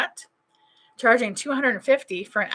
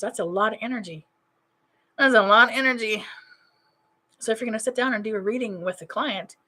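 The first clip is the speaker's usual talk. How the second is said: ordinary talk, nothing marked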